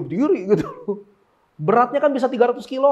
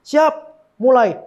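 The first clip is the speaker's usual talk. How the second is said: loud, higher call; acted starting command; 5 semitones higher, 7 dB louder, slower than usual. Acting a sparring start command. (aba-aba lantang)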